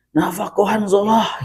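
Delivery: high-pitched quoted exclamation; exclamation, high intonation. Retold or imitated. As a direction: call it out high, as with an exclamation mark. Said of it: "Tanda seru, Pak".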